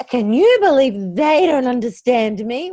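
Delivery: loud, put-upon griping; mock indignant complaint. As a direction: play up the outrage like a complaining gossip. Acted complaint about misunderstanding friends.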